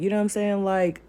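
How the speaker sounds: ordinary delivery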